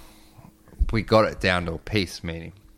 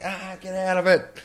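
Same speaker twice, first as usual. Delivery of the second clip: high-pitched frantic yelp; high, strained protest; retold panicked cry; 12 semitones higher than usual. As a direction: fend off attackers in a reenacted panic. Acted fending off attackers.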